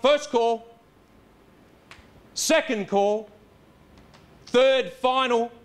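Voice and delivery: drawn-out, deliberate final call; slow final calls. Acted. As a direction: count down the final calls slowly. Auctioneer calling three times.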